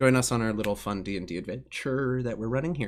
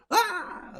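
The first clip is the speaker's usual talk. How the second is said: crowd cheer. Acted.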